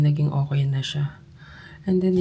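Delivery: ordinary talk, nothing marked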